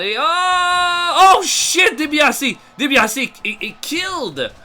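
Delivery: high, loud startled cry; shocked shout. Real, not acted. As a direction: yell out in disbelief. Shock at a big elimination.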